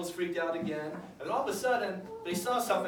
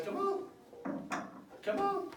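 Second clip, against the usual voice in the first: Jesus beckoning; 13 semitones higher, 5 dB quieter than usual. Acted. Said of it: "Jesus says".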